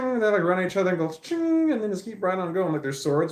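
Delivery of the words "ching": much higher, louder ringing clash sound; ping it out like two blades meeting; sword clash noise. Retold or imitated. Mimicking anime sword clash.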